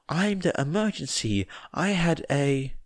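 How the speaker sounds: lightly voiced small girl explaining; child's earnest explanation; little girl Eri. A narrator's reading.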